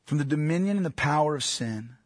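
hushed, reverent prayer voice. Real, not acted. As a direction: pray softly and humbly to God. Pastor prays before sermon.

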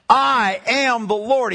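"I" drawn out and punched, the rest slow and weighty; emphatic declaration. Acted. Preacher quoting God in Isaiah, slow and emphatic on "I". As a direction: stretch "I", then place each word deliberately. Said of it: "He's emphasizing it".

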